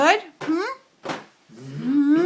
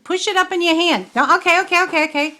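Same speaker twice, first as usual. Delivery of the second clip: excited; 6 semitones higher, 6 dB louder than usual. Real, not acted. Toddler making a mess.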